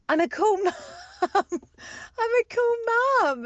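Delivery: high-pitched claim breaking into laughter; laughing mock protest. Friend retells defending herself to Sam, laughing mock protest.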